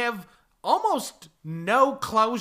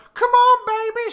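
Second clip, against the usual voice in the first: put-on southern accent; 13 semitones higher, 8 dB louder than usual. Girlfriend banging on the car door, high loud Southern call. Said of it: "got a southern accent for no reason".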